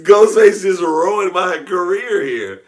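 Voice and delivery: high, loud, slow laughing wail; telling the ruined career, laughing loud; laughing panic. Real, not acted. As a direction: laugh through mock despair. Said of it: "freaking out, laughing".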